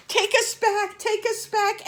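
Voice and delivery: high, loud panicked pleading; frantic quack-like plea. Acted. Eddie begging the bird to take them home, high and frantic. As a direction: beg desperately in a bird-ish squawk. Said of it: "Eddie quacked".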